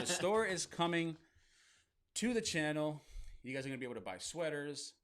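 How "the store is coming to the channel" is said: higher, louder, laughing through it; hyped announcement. Shown as a demonstration. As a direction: sell it big with a laugh. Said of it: "That sounds kinda like, it's kinda weird".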